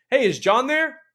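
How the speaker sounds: high, loud, cheerful hello; excited greeting. Shown as a demonstration. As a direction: call like you know them well. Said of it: "the first thing that I'm gonna say is".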